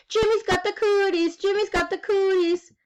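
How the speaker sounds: high playground chant; yelled taunt. A narrator's reading. Girl chasing boy at playground, yelling a high taunt. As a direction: tease in a high, yelling singsong. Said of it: "she yelled".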